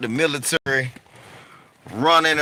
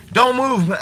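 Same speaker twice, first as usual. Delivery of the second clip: raised, higher-pitched command; insistent police order; 8 semitones higher, 4 dB louder than usual. Retold or imitated. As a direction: order a suspect to freeze. Cop repeats order, retold.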